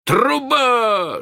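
high, loud cry of discovery; shouted. A narrator's reading. Two pirates discover a chimney, shouting it out. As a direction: shout out the sudden find. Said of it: "закричали они в один голос".

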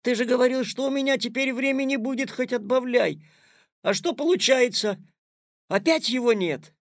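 put-on young boy feeling cheated; indignant protest. A narrator's reading.

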